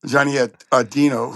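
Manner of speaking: ordinary talk, nothing marked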